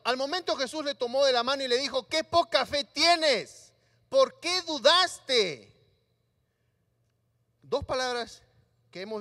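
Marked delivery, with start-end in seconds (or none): 2.1-5.7 slow, deliberate rebuke; slow gentle rebuke. Acted. Jesus holding Pedro's hand, rebuking him slowly and deliberately. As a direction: draw out each word with care.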